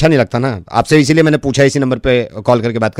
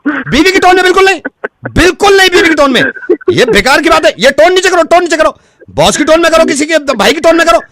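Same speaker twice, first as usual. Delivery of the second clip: very high, insistent ranting; hammed-up outrage; 14 semitones higher, 5 dB louder than usual. Technician forbids wife's tone to customer, outraged rant. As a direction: lay down the law in mock fury.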